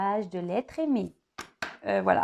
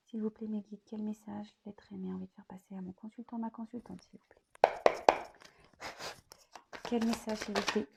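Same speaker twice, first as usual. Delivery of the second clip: much softer murmured prayer, sliding into a whisper; whispered invocation; 11 dB quieter than usual. Acted. Tarot reader pleading with her guides while shuffling, hushed whisper. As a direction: breathe the question to your guides.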